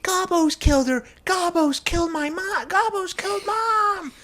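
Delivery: very high squealed wailing; mock hysterical child. Son telling dad about mom's death, mock hysterical shriek. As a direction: shriek it in a hammed-up panic. Boy tells dad mother was killed.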